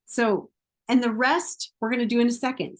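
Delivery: ordinary talk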